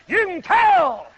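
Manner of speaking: emphatic shout; preaching shout. Real, not acted. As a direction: hammer it home to the crowd. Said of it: "You can tell, brother".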